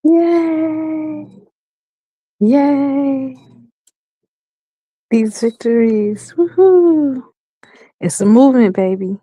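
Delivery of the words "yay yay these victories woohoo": excited. Real, not acted. Celebrating good news.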